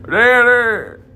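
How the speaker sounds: hammed-up high whining son; whiny son voice. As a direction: plead with daddy like a kid. Son voice pleading with father.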